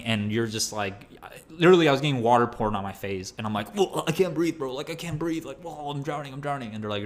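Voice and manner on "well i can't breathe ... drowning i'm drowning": mock panic. Panicking under the hose water.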